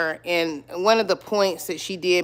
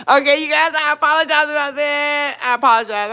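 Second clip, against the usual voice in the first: laughing apology; 8 semitones higher, 3 dB louder than usual. Real, not acted. Apologizing after the call interrupted.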